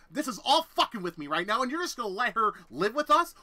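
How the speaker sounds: loud, rising furious rant; escalating rant. Retold or imitated. Ranting at the cop about the roommate, loud and rising. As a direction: build it into a threat.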